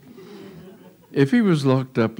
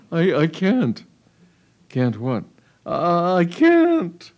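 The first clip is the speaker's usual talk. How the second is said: rising, higher pleading voice breaking upward; frightened refusal; 8 semitones higher, slower than usual. Acted. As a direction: plead with him, pitch climbing in fear. Daughter frightened before her father.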